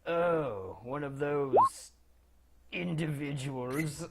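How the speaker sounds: low, quiet disdain, slowing on the last word; unimpressed low mutter; disdainful wizard. Acted. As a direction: size them up and drop it low and soft. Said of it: "looks you up and down and says".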